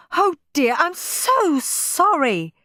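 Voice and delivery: high-pitched, drawn-out apology; flustered hostess, stretching the apology out; mortified apology. A narrator's reading. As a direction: apologise profusely in a high mum voice. Mum apologising to soaked guests.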